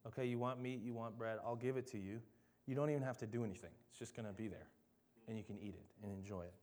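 a hint of a kindly, generous God; gracious God voice. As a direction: gently give the hungry people what they want. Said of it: "God is incredibly gracious".

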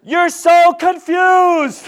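very high, loud, drawn-out complaint; mock exasperation. Rescuer despairs of person in the pit, exasperated slow holler. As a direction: stretch it out in hammed-up exasperation.

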